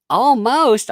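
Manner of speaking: high, loud mock indignation; mocking detractors. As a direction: imitate an outraged critic. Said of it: "they're like".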